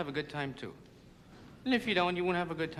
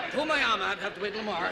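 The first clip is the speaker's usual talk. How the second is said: strained mock astronaut; 7 semitones higher, 3 dB louder, faster than usual. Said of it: "trying to launch a satellite".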